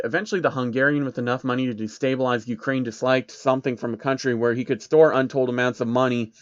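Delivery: ordinary delivery, nothing marked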